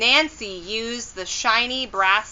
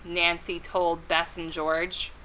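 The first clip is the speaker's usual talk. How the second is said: usual speaking voice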